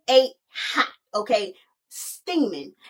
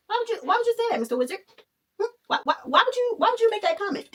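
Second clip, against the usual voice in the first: high mock indignant; 9 semitones higher, much faster than usual. Teller scolds the Wizard, high and mock-indignant. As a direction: pitch jumps up, sassy outrage.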